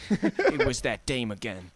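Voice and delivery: slow, low, soft hard-boiled voice; noir detective. Acted. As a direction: brood over that dame like a gumshoe. Joining the noir voice bit.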